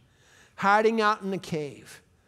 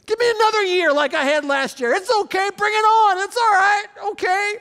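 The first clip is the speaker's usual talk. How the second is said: much higher, louder, shouted brush-off; mock the careless listener's bravado; hammed-up careless sinner, shouting high; mocking the stubborn one; 12 semitones higher, 9 dB louder than usual. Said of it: "are you positioning yourselves".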